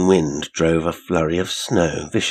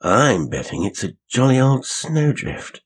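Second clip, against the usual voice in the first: high, lively exclamation; chatty exclamation; 7 semitones higher, 3 dB louder than usual. A narrator's reading. Loquacious young man to the coach, exclaiming high. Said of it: "exclaimed the more loquacious young man".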